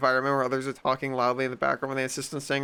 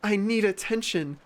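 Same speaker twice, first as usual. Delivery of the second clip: higher, whiny, overdramatic victim; mock self-pity; 6 semitones higher than usual. Mocking attention seekers, high whiny self-pity. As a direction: whine like a drama queen. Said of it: "they're like".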